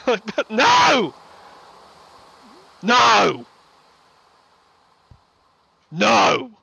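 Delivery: loud, high cries of protest; exasperated outcry. Real, not acted. Viewer ranting that the scene is wrong, exasperated outcry.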